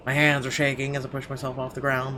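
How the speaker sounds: normal talking voice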